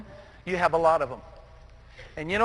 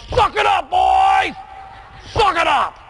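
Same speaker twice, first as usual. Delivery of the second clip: coach shouting; 10 semitones higher, slower than usual. Retold or imitated. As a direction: yell it down the field like a football coach. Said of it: "His favorite comment is".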